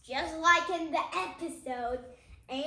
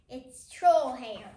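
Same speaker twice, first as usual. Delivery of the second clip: emphatic; 3 semitones lower, 9 dB louder, slower than usual. Acted. Emphatic reading.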